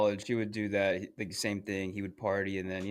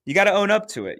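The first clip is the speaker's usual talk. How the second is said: much higher, louder emphatic advice; 11 semitones higher, 11 dB louder, faster than usual. Real, not acted. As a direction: hammer the point home loudly. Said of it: "you know".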